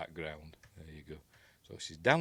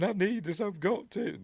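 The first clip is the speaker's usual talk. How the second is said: mock hysterical; 12 semitones higher than usual. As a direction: imitate a desperate, screaming-and-sobbing plea in a high voice. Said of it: "getting all hysterical".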